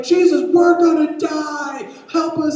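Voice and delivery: slow, high, loud scream; panicked yell, every word stretched; repeated desperate shout. Acted. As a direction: draw it out over the storm. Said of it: "Can you repeat that?".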